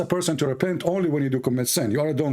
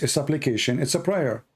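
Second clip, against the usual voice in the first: ordinary talk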